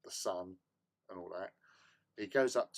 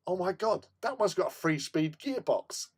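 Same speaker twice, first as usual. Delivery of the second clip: higher, thrilled gushing, forgetting the embarrassment; excited; 12 semitones higher than usual. Retold or imitated. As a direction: gush over the gadget like a kid. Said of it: "I've completely forgotten about it".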